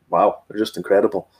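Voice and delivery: ordinary talk, nothing marked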